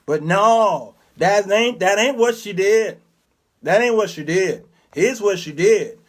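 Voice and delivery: ordinary delivery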